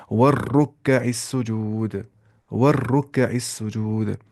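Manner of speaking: वर्रुक्कइस with a held heavy r, slow; heavy doubled r. Shown as a demonstration. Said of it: "तो मोटी आवाज़ से पढ़ना है". (मोटी आवाज़ से वर्रुक्कइस सुजूद)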